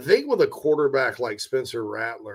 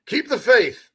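laughing rally cry; 10 semitones higher, 6 dB louder, slower than usual. Acted. Cheery rallying cry to fans.

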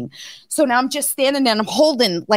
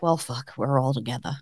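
much lower and softer, a resigned shrug; resigned calm; 10 semitones lower, 9 dB quieter than usual. Retold or imitated. Imagining a plane crash with family, quiet resignation. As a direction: accept the worst, quietly. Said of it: "it's like".

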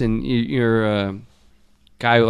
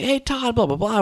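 friendly dad; 10 semitones higher, 4 dB quieter than usual. Retold or imitated. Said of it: "being, like, really cool with me".

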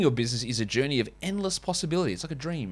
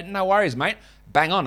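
lightly played-up Australian accent, higher pitch; 6 semitones higher than usual. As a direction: answer like a chirpy Australian concierge. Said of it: "an Australian".

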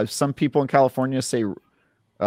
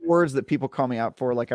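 ordinary talk, nothing marked

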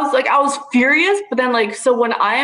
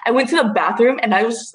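ordinary delivery

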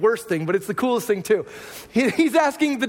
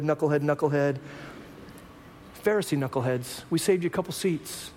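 tender invitation; 6 semitones lower, 5 dB quieter than usual. Retold or imitated. Jesus invites at the table.

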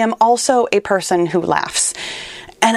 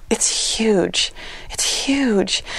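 hushed awe; 3 semitones higher, 4 dB quieter, much slower than usual. Real, not acted. Overwhelmed by the topic.

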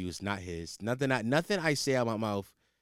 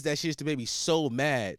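usual speaking voice

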